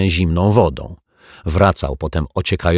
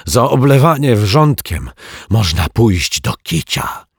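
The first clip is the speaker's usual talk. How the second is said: rasping threat; 4 semitones higher, 4 dB louder than usual. Acted. The lodger threatening the detective, rasping. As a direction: rasp out the threat. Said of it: "wycharczał martwiak".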